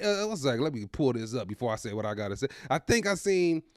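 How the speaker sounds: usual speaking voice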